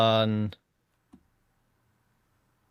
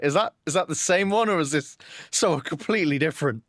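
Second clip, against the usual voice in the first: higher-pitched puzzled self-quote; incredulous question, pitched up; retold disbelief; 5 semitones higher, faster than usual. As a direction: relive the double-take at a name. Acts out own puzzlement at credits.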